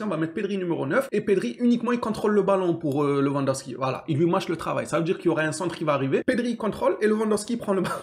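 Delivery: usual speaking voice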